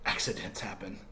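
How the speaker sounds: hushed, sorry whisper. Acted. Humpty telling children his plane broke, whispered. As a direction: breathe it out like bad news.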